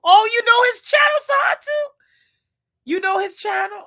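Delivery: high, loud delighted disbelief; thrilled shout of recognition; excited surprise. Real, not acted. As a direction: exclaim at finding a fellow fan. Surprised someone knows the cook.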